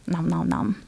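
lightly voiced munching cell; a hint of a small creature gobbling, kept soft. Host acting out cells swarming nutrients, light cell voice. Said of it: "my cell voice".

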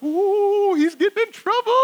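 very high sing-song taunt; excited kids. Retold or imitated. Siblings watching one get summoned, high gleeful taunting. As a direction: draw out a giddy, teasing ooh. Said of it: "we're getting all excited".

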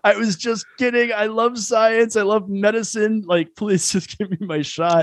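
high, drawn-out mock pleading; pleading apology. Patient begging the woman for his booster shot, mock pleading. Acted backpedaling to angry woman.

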